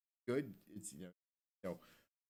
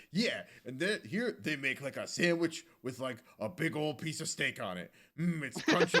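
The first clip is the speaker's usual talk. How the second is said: TV food host voice; 4 semitones higher, 4 dB louder than usual. Retold or imitated. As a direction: ham up a food TV host savoring a bite. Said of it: "Diners, Drive-Ins, and Dives is like".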